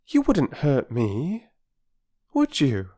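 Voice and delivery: put-on young Bobby, gentle. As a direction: coax a strange dog.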